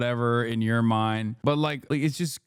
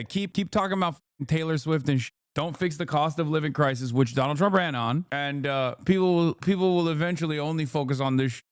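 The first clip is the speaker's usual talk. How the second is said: ordinary talk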